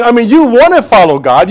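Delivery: usual speaking voice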